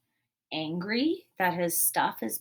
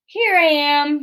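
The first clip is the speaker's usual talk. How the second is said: much higher, louder playful shout; 9 semitones higher, 11 dB louder than usual. Acted. Peter surprising his mother from hiding. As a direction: pop out and yell it. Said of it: "shouted Peter".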